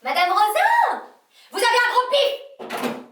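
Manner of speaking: slow, high, loud shout; loud taunting call. Shown as a demonstration. Secretary showing how she phones old patients, loud taunt. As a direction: holler it with drawn-out vowels. Demonstrates phoning former patients rudely.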